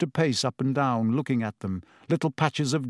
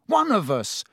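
sharp, raised angry outburst; 7 semitones higher than usual. A narrator's reading. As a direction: snap it out angrily. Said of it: "Ron said angrily".

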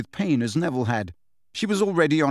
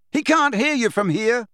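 raised, high-pitched shout; yelled retort; 9 semitones higher, 3 dB louder than usual. A narrator's reading. Harry shouting down the witch's pleading, yelled. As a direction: call it out over her pleas. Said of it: "yelled Harry".

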